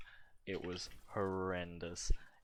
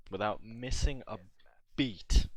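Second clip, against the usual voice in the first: emphatic shout; 3 semitones higher, 10 dB louder than usual. Real, not acted. Punchline build, voice jumps loud.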